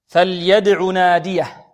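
slow drawn-out recitation; تلاوة مجودة. Shown as a demonstration. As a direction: recite slowly, ringing the dal. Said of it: "يتلو الآية". (تلاوة بطيئة مع قلقلة الدال)

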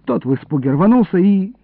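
plain reading voice

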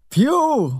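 high, loud holler; one-word bellow; roared call. A narrator's reading. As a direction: yell up to someone out of reach. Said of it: "ordította".

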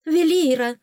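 high, loud urgent call; agitated. A narrator's reading. Lady-in-waiting rushes to heroine, agitated high cry. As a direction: call out in a flurry. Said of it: "взбудораженная Лира Марасти".

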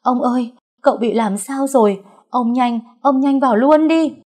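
high, loud urgent cry for help; panicked shouting. Acted. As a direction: call the master in, alarmed. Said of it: "bà Vú la hét". (la hét hốt hoảng, giọng cao)

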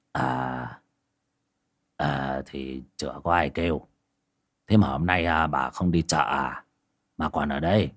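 put-on old man Quyền answering his wife, low and unhurried. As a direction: play the weary old village husband, low and quiet. Old husband Quyền voiced.

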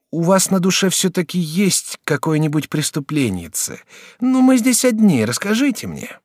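put-on young student Jacques. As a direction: play the courteous young student.

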